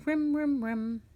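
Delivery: high nervous monkey chatter. Acted. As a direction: chatter anxiously. Monkey nervously glancing about.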